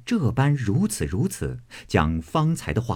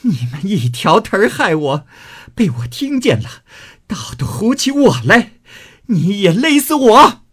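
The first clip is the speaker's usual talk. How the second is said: high wailing scream, building louder; hysterical crying out; 11 semitones higher, 6 dB louder than usual. Acted. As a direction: shriek the accusation until it peaks on a desperate dare. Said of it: "叫道". (撒泼哭叫，又高又响)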